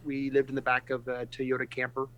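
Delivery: ordinary talk, nothing marked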